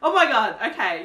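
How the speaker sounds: normal talking voice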